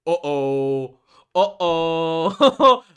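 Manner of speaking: loud, high, sing-song uh-oh, rising the second time; a theatrical uh-oh of dread; playful panic at the bad news; mock alarmed uh-oh. Real, not acted. Reacting to the father news.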